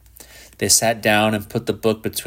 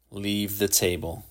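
quiet, cold dismissal; soft, controlled icy order; 8 dB quieter than usual. A narrator's reading. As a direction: send him away coldly and softly. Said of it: "he said coldly".

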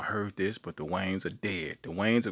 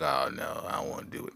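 whiny whisper; 4 semitones lower, 3 dB quieter than usual. Retold or imitated. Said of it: "and I'm like".

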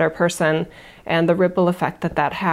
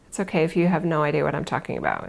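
normal talking voice

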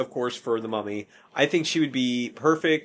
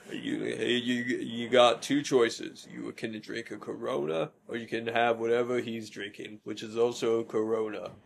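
mock Vin Diesel; 3 semitones lower, 6 dB quieter than usual. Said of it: "how a British sounding Vin Diesel".

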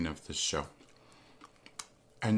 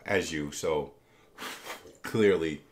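usual speaking voice

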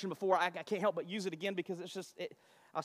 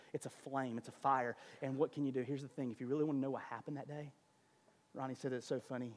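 ordinary delivery

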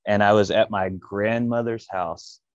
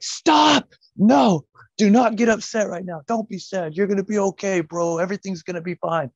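high, loud imitated friend cutting him off; urgent pep talk; 11 semitones higher, 4 dB louder than usual. Eddie cutting off his friend's cracking voice, high urgent reassurance. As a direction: stop him from getting upset, high and pushy.

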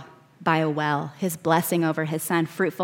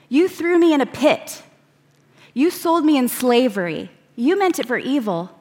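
higher, louder, pained accusation; Joseph confronting brothers; 5 semitones higher, 3 dB louder than usual. Acted. Joseph to the brothers who betrayed him, pained accusation. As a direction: call them out, wounded.

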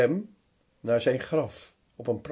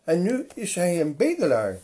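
usual speaking voice

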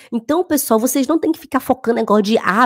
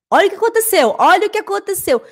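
higher, amazed repeat; excited exclamation; 8 semitones higher, faster than usual. Real, not acted. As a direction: point it out with delight.

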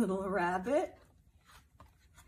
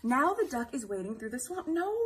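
ordinary talk, nothing marked

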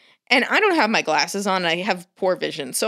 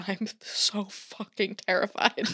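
lightly voiced scared possum, softer; a hushed, half-laughing whimper; barely voiced possum panic; mock terrified possum; 9 dB quieter than usual. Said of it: "was like".

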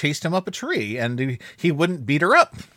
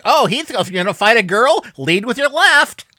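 loud, raised-pitch mocking jeer; Hector taunting; 6 semitones higher, 6 dB louder than usual. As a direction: taunt him like a cartoon alley cat. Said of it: "I like Hector taunting him".

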